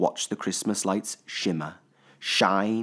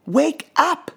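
put-on child voice; 7 dB louder than usual. A narrator's reading. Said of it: "said Little Pip".

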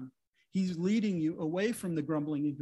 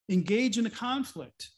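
ordinary talk, nothing marked

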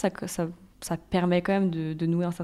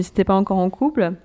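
ordinary delivery

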